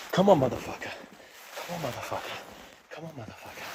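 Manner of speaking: strained urging, fading; gritted-teeth push; straining. Real, not acted. Pushing through fear on steps.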